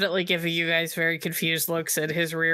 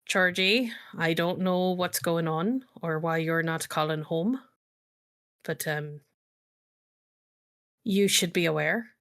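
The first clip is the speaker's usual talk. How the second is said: lightly voiced grandfather, halting with long pauses; measured, understated grandfather leaving a message; grandfather's voicemail. Grandfather leaves a voicemail for his missing grandchild, lightly voiced and halting. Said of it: "it's your grandfather".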